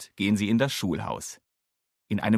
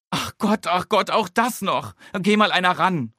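high, loud frazzled call; overwhelmed, calling out to anyone; Mama calls out in a fluster; 9 semitones higher, 6 dB louder than usual. A narrator's reading. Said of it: "ruft Mama".

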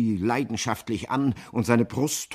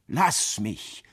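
ordinary delivery

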